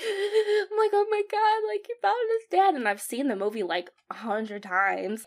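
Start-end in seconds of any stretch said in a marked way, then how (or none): 0.0-2.8 high, gasping mock sob; imitated bawling. Viewer watching son find his dad, mock bawling. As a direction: act out bawling at a reunion scene. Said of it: "like, I bawl".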